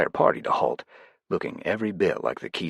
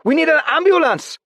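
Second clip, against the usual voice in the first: put-on very high, loud Chinese-accented cry; Chinese-accented, very high, loud shout; accented English shout; 10 dB louder than usual. A narrator's reading. Continued accented alarm.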